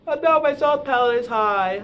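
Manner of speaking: mock dismay. Shown as a demonstration. Demo of high soft palate voice.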